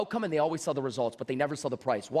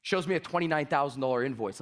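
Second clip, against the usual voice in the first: ordinary delivery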